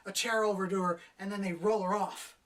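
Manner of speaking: ordinary talk, nothing marked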